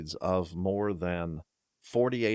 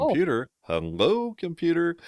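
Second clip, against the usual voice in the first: higher, louder call of "Computer, hello"; Scotty talking to computer; 11 semitones higher, 7 dB louder than usual. Retold or imitated. Host retelling Scotty addressing a Mac, hammed-up call. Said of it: "He said".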